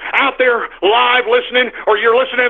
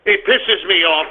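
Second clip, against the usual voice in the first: ordinary delivery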